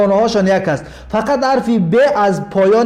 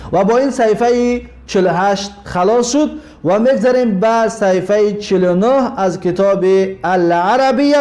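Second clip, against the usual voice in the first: ordinary talk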